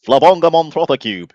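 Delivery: loud, high mock-Mancunian burst; terrible Mancunian accent. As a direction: blurt it in a badly faked Mancunian accent. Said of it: "That was my terrible accent".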